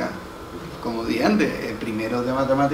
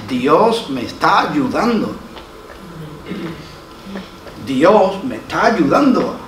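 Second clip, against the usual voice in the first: slow repeated declaration; slower than usual. Shown as a demonstration. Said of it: "tú tienes que decir".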